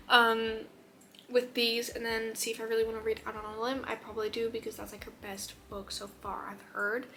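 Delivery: usual speaking voice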